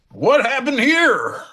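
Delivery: high, slow, laughing Kid impression; Kid's voice. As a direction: stretch it high and slow, cracking up. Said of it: "Kid comes around the corner and goes".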